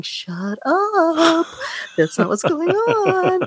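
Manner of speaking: high, laughing mock of scolding ladies. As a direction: shush her in a squeaky society-lady voice. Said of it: "all the other ladies are going".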